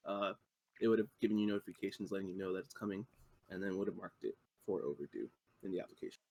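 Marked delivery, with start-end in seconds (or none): none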